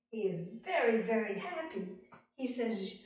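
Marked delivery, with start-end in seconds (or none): none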